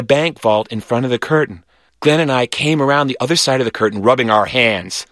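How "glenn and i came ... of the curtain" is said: ordinary delivery, nothing marked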